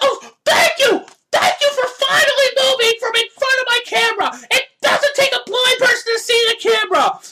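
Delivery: high, loud mock-grateful shout; sarcastic ranting thanks. Real, not acted. Host mocking the man who finally moved, sarcastic shout. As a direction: sing out sarcastic relief. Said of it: "rage out about that".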